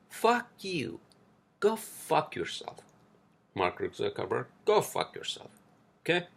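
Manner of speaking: high, cursing outburst at Zuckerberg; furious rant. Real, not acted. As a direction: spit the curses up high at him. Ranting at Zuckerberg.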